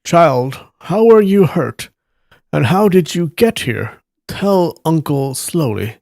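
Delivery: kindly elder's tender concern; gentle concern. Acted. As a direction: coax the story out of a hurt child. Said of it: "Yi Deng said gently".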